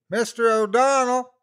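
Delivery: high, loud call across the valley; distant shout. A narrator's reading. Searcher calling the lost hiker's name, shouting. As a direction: call out for a missing man from the ridge.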